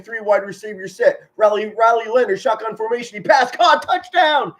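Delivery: building from plain call to a high, loud touchdown shout; mock play-by-play. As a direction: call it like you're on the couch going nuts. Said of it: "I'd be saying".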